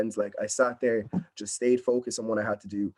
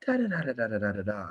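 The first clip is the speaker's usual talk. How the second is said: bubbly chatter; 4 dB quieter than usual. Retold or imitated. Recalling her chatty audition self, mock bubbly babble. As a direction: imitate her old bubbly self nattering away. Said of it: "before I was quite bubbly".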